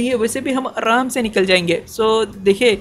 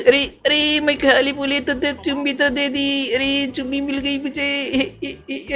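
put-on higher, giggly bashful girl; coy girl voice; 5 semitones higher, 3 dB quieter than usual. Heroine to the hero after a kiss, lightly voiced coy girl. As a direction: gush like a girl who just got kissed.

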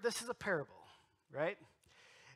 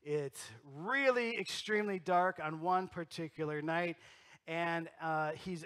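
ordinary delivery

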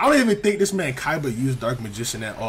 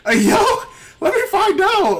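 loud, high disbelieving yell; shocked hype; 7 dB louder, slower than usual. Real, not acted. Gamer yells at Isabelle's sudden combo, loud and high. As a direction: yell at the screen in laughing disbelief.

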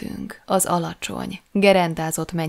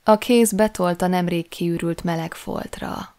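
usual speaking voice

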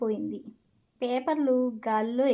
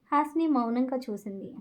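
ordinary delivery, nothing marked

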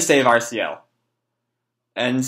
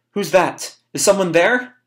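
put-on higher, wary child character; acted nervous query; 6 semitones higher than usual. Boy hears a noise, nervous query. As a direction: ask warily in a raised pitch.

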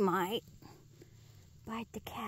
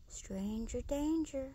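normal talking voice

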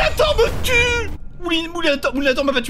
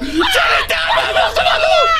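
much higher, loud, screamed through laughter; screaming laugh; 14 semitones higher, 5 dB louder than usual. Real, not acted. Player repeating the warning to her team, screaming laugh. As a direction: scream it again, laughing in panic. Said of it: "Mais il y a littéralement un monstre".

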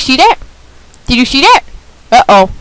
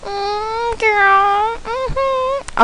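sassy; 9 semitones higher, 8 dB quieter, much slower than usual. Real, not acted. Sassy reaction.

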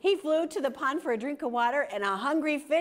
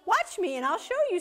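honeybee; 8 semitones higher, faster than usual. A narrator's reading. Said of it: "said the honeybee".

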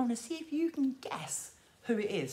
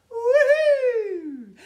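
loud, high, long falling whoop; excited whoop; 10 semitones higher, 10 dB louder than usual. Pretend. Leader turning Big Ted around, whooping. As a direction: whoop as if spinning round. Teddy spins round.

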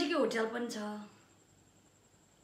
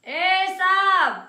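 loud, higher, drawn-out hail; insistent call; 4 semitones higher, 10 dB louder, much slower than usual. Acted. Hailing sir to ask the time, calling insistently. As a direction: stretch the call out loudly. Repeats the call to get attention.

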